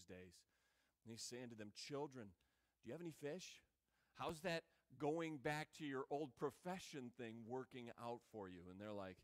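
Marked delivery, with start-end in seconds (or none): 1.7-8.7 soft and lower, fond and gentle; tender. Retold or imitated. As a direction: say it softly like a term of endearment. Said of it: "giving a very tender word".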